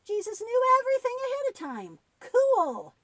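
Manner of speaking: high, loud burst of amazement; excited. Acted. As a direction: blurt it out, thrilled. Boy puppet amazed at story.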